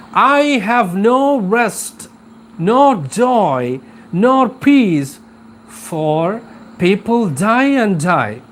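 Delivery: deliberate, stressed poetic delivery; poem recitation. Acted. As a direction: recite the lament line by line, drawn out. Reciting the poem's lament aloud.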